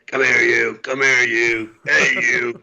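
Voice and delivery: squinty cartoon sailor growl; Popeye voice. Recalling drill instructors, in a Popeye sailor voice. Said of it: "sounding like Popeye".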